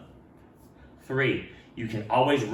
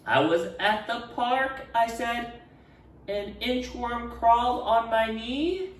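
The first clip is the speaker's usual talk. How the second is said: higher, slow, hesitant; shy boy voice; 10 semitones higher, slower than usual. Acted. Ralph sharing his story, higher and slow. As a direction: say it timidly to the class.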